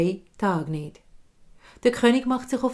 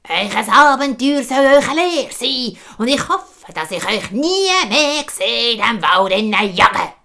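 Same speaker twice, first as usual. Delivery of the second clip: loud, high parting call; 4 semitones higher, 8 dB louder than usual. Acted. Old woman calling from the forest, loud high warning. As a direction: shout a warning after them. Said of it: "hören sie nur noch die Alte rufen".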